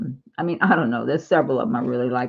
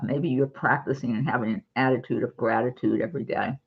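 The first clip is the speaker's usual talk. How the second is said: usual speaking voice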